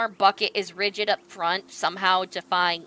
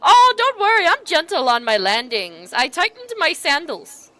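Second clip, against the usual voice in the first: higher, bright reassurance; cheerful merc; 6 semitones higher, 6 dB louder than usual. Acted. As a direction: brush off their worry. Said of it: "he's just, uh, like".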